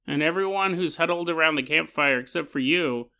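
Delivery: ordinary talk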